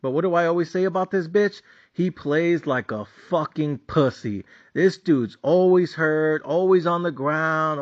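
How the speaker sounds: normal talking voice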